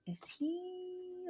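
high, soft, tentative question; wondering aloud. Retold or imitated. Mum questioning her son's energy, high soft wondering. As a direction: voice a private doubt, high and soft. Retold worried thought to herself.

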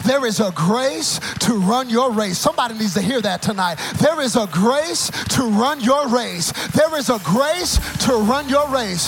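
loud, high, rhythmic sermon climax; full-force preaching. Real, not acted. Preacher telling church to run their race, fiery preaching. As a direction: build from firm declaration to soaring shout.

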